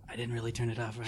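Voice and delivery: very low, soft confidential murmur; hushed aside. Pretend. Crew member secretly admits the mic is on, hushed. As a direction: keep it down, barely audible. Pretending the mics are off.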